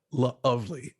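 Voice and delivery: "Lovely" with the L held, then "ovely"; emphasis after the L. Shown as a demonstration. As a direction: draw out the start of "Lovely". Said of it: "The ovely after the L".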